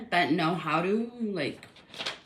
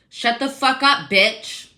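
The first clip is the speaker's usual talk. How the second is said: retold yell; 4 semitones higher, 5 dB louder, slower than usual. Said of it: "She yells from the office".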